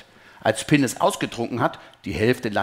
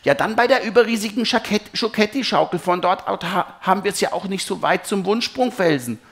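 hohe, aufgeregte Kinderstimme; eifriges Kind; 8 semitones higher, 4 dB louder than usual. A narrator's reading. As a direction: schlag es eifrig und hoch vor. Kind schlägt Papa anderes Ziel vor. (eifriger Kindervorschlag, deutlich höher)

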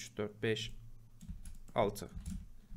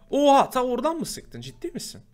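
startled surprise; 9 semitones higher, 6 dB louder than usual. Real, not acted. Skeleton shot from far away.

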